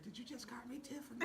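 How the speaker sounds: a hushed, put-out little jab at the nickname; imitating Tiffany. [name] imitating Tiffany, miffed at being called Tiffany, in a mock-offended whisper. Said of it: "She gonna be mad".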